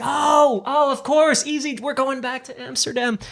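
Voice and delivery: high-pitched dawning recognition; sudden realization. Retold or imitated. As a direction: act out the penny dropping, high voice. Acting out his sudden realization.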